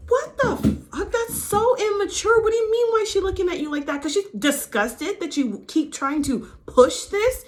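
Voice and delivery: high, loud, exasperated disbelief; real outraged rant, pitch shooting way up; indignant outburst. Real, not acted. Host reacting to Rena's question, in high-pitched indignant disbelief.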